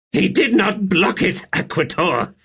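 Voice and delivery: high, loud breathless exclaim; gasping outburst. Acted. Buruk to Seren after the blast, gasping loudly. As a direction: blurt it out, shaken. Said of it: "he gasped".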